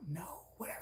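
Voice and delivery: breathy whispered brush-off, no voice at all; whispered sulk. Retold or imitated. Pouting at her husband, a sulky whisper.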